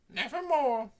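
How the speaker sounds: the raven Mortimer squawking high and loud; raven croak. A narrator's reading. Raven speaks.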